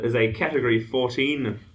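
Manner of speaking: normal talking voice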